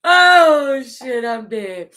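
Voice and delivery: high burst fading to a weak slow wheeze; dying laughing. Real, not acted. Reader cracks up at her friend's antics. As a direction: laugh till you can't breathe.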